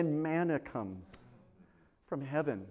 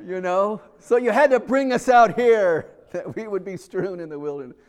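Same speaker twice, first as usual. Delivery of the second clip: mock indignant complaint; 5 semitones higher, 7 dB louder than usual. Israelites complain to Moses.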